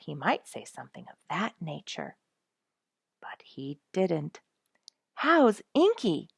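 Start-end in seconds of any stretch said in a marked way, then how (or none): 5.1-6.3 concerned. A narrator's reading. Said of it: "in utmost interest and concern".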